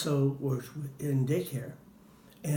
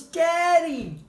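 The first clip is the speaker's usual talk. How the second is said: put-on high, loud happy child's cry; child shouting Daddy; 8 dB louder than usual. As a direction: call out like an excited little boy. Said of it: "he runs and goes".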